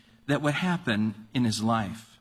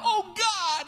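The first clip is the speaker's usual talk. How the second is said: panicked cry. Retold or imitated. Preacher acting the falling roofer crying out in panic. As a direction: yelp it like a man mid-fall. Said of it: "he's crying out".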